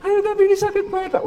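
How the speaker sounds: high, pained plea; a hint of an aching old woman begging; old mother pleading. Elderly mother to dismissive child, high pleading voice.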